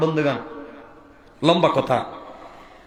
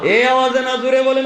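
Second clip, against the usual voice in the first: rallying the crowd; 9 semitones higher, 4 dB louder than usual. Real, not acted. Urges crowd to respond louder.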